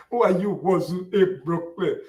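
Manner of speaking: slow, sneering mimic of the question; mocking the critics. Host ridiculing critics who crawl out asking the question, slow mock. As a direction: ape the critics with scorn. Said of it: "These guys will just crawl out".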